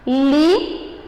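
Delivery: "Li" isolado, alongado e firme; sílaba mais forte. Shown as a demonstration. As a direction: destaque "Li" como sílaba tônica. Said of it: "foi a minha palavra mais forte". ("Li" com mais força)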